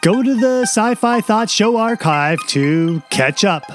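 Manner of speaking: podcast host intro. Acted. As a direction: introduce the series like a show host. Reads the show intro.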